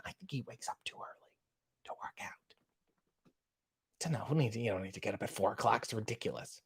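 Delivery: very soft, half-whispered grumble; barely audible aside, starting in a whisper; hushed aside. Real, not acted. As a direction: grumble almost inaudibly, as if to yourself. Hushed aside on early rising.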